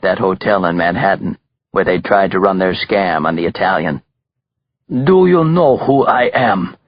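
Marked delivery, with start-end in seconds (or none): 4.9-6.8 put-on slow, higher-pitched heavy Russian accent. A narrator's reading. Belova confronts the killer, heavy Russian accent. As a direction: demand it slowly in a thick Russian accent. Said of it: "he asked in his heavy Russian accent".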